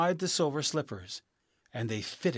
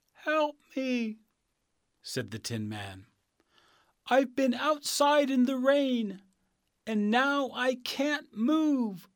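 much higher, creaky-stiff tin man pleading for help; a stuck tin man begging, high and slow; rusted Tin Man; 12 semitones higher than usual. Acted. Stuck in the rain, the Tin Man begs Dorothy for help, high and pleading.

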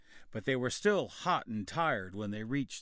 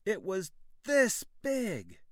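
put-on slow, higher boy's boast; exaggerating boy; 9 semitones higher, 5 dB louder, slower than usual. A narrator's reading. Boy telling the men about a huge wolf, slow. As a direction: stretch it out to impress.

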